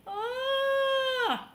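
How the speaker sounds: long, high wail; sustained high-pitched cry of fright; freaked-out "ah". Retold or imitated. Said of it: "I was freaking out".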